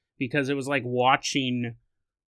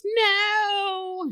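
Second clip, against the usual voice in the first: put-on high little girl's no; a toddler refuses; child's no. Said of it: "she goes".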